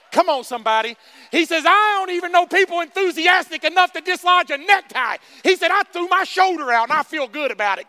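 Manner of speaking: loud, high preaching; fired-up sermon; full-force preaching. Acted. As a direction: preach it, building.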